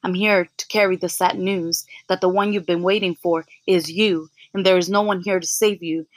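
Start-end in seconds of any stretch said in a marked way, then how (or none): none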